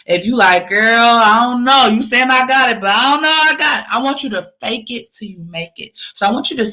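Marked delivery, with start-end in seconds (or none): 0.7-3.9 acted self-doubt. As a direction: voice a friend who doesn't believe it. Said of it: "if you don't believe it".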